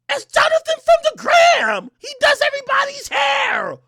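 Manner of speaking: put-on very high, loud incredulous girly voice; mimicking girlfriend. Narrator imitating his date shocked he is mad. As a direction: squeal in disbelief like her. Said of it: "her reply".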